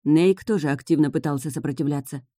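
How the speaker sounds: ordinary delivery, nothing marked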